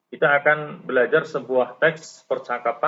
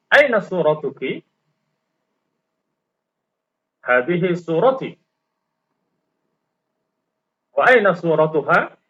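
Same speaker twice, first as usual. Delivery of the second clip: dialogue read for students to repeat, questions rising; model reading with intonation. Shown as a demonstration. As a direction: model each line with clear rises and falls. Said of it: "harus ada intonasinya, ada naik turunnya". (contoh bacaan bersama dengan intonasi jelas)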